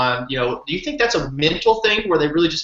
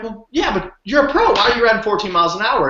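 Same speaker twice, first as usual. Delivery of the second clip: much higher, fast, disbelieving question; incredulous protest; 7 semitones higher than usual. Retold or imitated. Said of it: "And I'm like".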